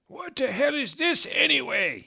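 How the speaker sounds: very high, mean Dumbledore voice; mean cackle. Acted. Dumbledore mocking the pop song he took. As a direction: sneer it out high and nasty. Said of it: "he cackled meanly".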